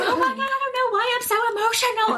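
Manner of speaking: high, fast, laughing mock husband; a wife mimicking her emotional husband; imitating teary husband. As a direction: play the flustered crying husband, quick and high. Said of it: "He's like".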